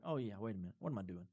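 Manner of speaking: low, hushed aside to oneself; chastened self-talk. Acted. Believer gazing at his own testimony, quietly catching himself. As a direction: drop the voice as if catching yourself.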